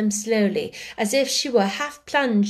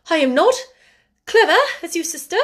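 higher, louder voice of a timid sister speaking up; earnest, high-pitched protest; 8 semitones higher, 6 dB louder, faster than usual. A narrator's reading. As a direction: voice a meek woman nervously standing up to her sister. Meek sister starts to speak up.

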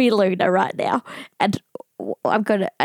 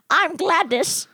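put-on high quavery old lady; 7 semitones higher, slower than usual. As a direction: play a chatty old granny. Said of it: "me imitating an old lady".

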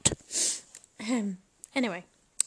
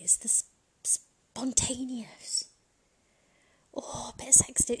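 slow, hushed whisper; 3 semitones higher, slower than usual. Real, not acted. Musing to viewers about sexting, slow whisper. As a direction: savour it in a whisper. Savouring the spontaneity.

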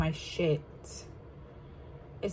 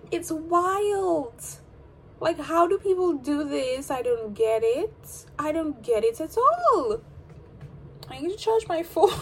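excited; 8 semitones higher, 4 dB louder than usual. Real, not acted. Excited, high after laugh.

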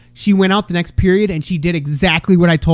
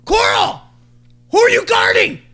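sideline yell. Retold or imitated. Sports dad yelling at his daughter on court, shouting. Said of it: "I'm yelling from the sidelines. I'm like".

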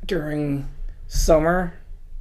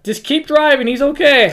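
higher, louder matter-of-fact phone caller; 9 semitones higher, 6 dB louder than usual. Retold or imitated. Friend on the phone telling the driver to keep going, higher casual voice. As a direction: play the calm friend on the phone.

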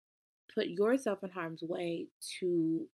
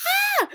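very high, loud happy shriek; sudden fangirl scream; delighted squeal; 13 dB louder than usual. Real, not acted. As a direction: squeal with fan joy. Squealing over favorite character.

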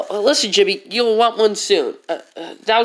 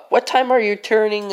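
normal talking voice

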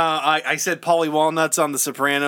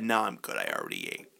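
soft, low mock-mother answer; quiet, deflating punchline; subdued mimicked reply; 12 dB quieter, faster than usual. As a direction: drop right down, low and offhand. Mother's anticlimactic answer after shouting.

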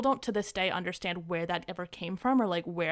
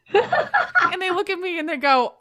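laughing; 10 semitones higher, 8 dB louder, slower than usual. Real, not acted. Laughing while recalling the moment.

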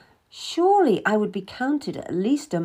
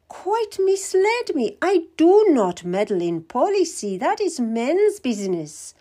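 queen feigning innocence; 8 semitones higher, 4 dB louder than usual. Acted. Queen pretending confusion to the Cardinal, much higher and sweet. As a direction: play the confused lady to disarm him.

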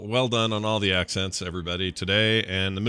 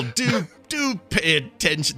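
a light mock of the prim scold; imitating Q; 6 semitones higher than usual. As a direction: echo the quartermaster's fussy line. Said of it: "That whole thing is, like, totally him".

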